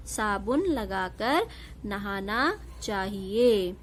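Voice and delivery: slow, clear dictation-style reading. Shown as a demonstration. Teacher reading the finished sentence slowly to pupils. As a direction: read the sentence slowly for children.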